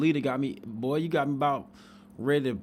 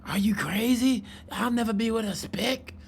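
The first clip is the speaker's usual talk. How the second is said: higher mock-indignant girl's voice; imitating the girl; 8 semitones higher than usual. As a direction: play her scoffing reply, pitched up. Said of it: "This girl turned around and say".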